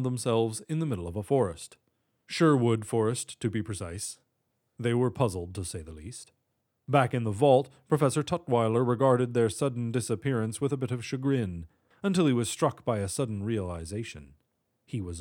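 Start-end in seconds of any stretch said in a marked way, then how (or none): none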